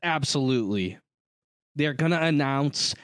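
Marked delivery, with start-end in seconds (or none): none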